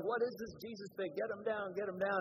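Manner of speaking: loud mock heckling shout; retold yelling. Protesters shout down the preacher, loud retold yelling. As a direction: heckle him like an angry crowd. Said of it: "started yelling".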